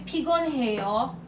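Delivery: 피곤해요 said fast with a rising question tune. Shown as a demonstration. Teacher showing students 피곤해요 as a question, rising tune. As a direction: ask 피곤해요 with the pitch going up at the end. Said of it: "with the question tone".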